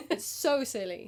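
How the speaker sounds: ordinary talk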